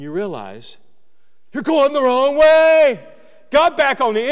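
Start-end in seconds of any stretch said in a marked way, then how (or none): 1.5-3.0 higher, louder and slow alarm; the moment you see your mistake; a slow, jolted cry of alarm; shocked realisation. Retold or imitated. Sudden realisation while driving.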